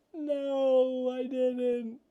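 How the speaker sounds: high, drawn-out mock sob; imitated sobbing. Imitating the partner's tearful answer to mom's question, mock sobbing. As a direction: wail it in a squeaky imitation of crying. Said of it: "you're sobbing".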